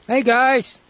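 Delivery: high cheery shout down; calling out. Acted. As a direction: call out to them from up high. Calling out to council below.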